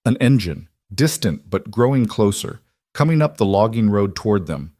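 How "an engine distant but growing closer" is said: ordinary delivery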